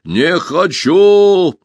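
slow, drawn-out booming creature call; muffled monster voice. A narrator's reading. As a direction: loom over the forest with a hollow voice. Monster voice continues.

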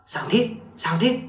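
high, quick-repeated worried question; alarmed asking. A narrator's reading. Grandfather helping the vomiting grandchild, mildly alarmed asking. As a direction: ask with understated panic, each question rising. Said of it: "liền hốt hoảng dìu hỏi".

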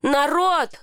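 high-pitched call for attention; restrained narrated yell; mild yell. A narrator's reading. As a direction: cry out "people!" without full volume. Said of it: "завопил я".

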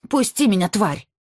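high angry cry, struggling; struggling against restraints. A narrator's reading. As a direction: spit it while wrestling free. Struggling to break free.

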